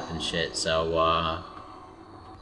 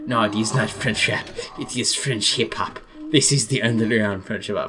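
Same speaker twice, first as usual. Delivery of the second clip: hammed-up French accent; mock French accent; 3 semitones higher than usual. French rapper corrects a critic, mock French accent. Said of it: "I'm doing some joking imitations".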